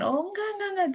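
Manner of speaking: quick high-pitched no no no; reassuring denial. Retold or imitated. Mother reassuring her daughter with high quick denials. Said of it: "kita explain gitu kan".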